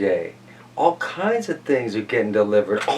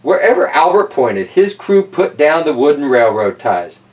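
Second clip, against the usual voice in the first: lightly lifted, louder reading-aloud voice; storybook reading; 4 dB louder than usual. Acted. Reading Tracks to kids, storybook voice. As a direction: read it out to the children. Reads picture book aloud.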